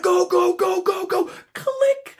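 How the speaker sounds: high, loud rapid-fire chant; mock-frantic shout building to the click; frantic urging. Bidder hitting the button, frantic shout.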